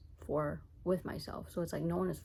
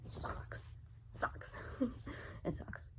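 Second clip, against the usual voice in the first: whisper; 5 semitones higher, 8 dB quieter, much slower than usual. Real, not acted. Repeating sucks in a whisper.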